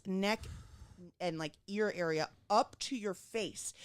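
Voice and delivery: low, grave doctor delivering a warning; stern surgeon. Retold or imitated. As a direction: imitate a solemn surgeon, pausing between body parts.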